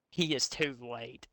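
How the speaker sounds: usual speaking voice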